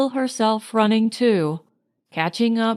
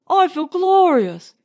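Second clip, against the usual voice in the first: higher and louder, ringing exclamation; exuberant; 8 semitones higher, 4 dB louder than usual. A narrator's reading. Leonard exulting to Marjorie on the beach, ringing. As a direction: shout the joy out.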